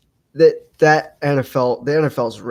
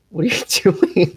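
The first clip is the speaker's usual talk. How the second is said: laughing question; 6 semitones higher than usual. Real, not acted. Guest distracting him on camera.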